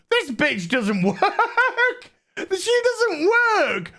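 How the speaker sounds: high, drawn out through laughter; laughing disbelief. Real, not acted. Reviewer disbelieving the mum has work, laughing and squealing. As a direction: crack up on a slow, high wail.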